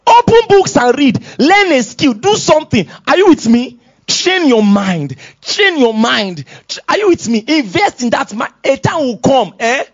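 high, emphatic exhortation; fired-up pulpit delivery; forceful preaching. Real, not acted. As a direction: urge the congregation on with punched commands.

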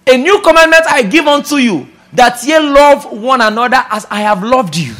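loud, lifted scripture declamation; preaching at full force. Acted. Preacher repeats new commandment to church, preaching at full force. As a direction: drive the commandment home to the congregation.

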